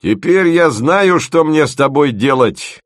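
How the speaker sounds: громче и выше, с умилением; обрадуйся находке, растроганно; умилённо. A narrator's reading. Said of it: "умилённо сообщила стихия". (начальница умилённо объявляет студенту его новую роль)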